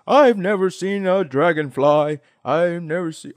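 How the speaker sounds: high, loud, slow announcer delivery; mock fight-night announcer; announcer voice on crow lines. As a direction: boom the crow's line like a ring announcer. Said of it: "he's like".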